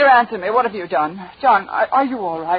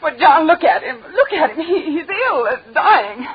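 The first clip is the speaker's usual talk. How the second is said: sobbing; 8 semitones higher than usual. Acted. Wife breaks down at dying lover.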